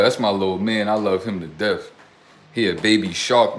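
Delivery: normal talking voice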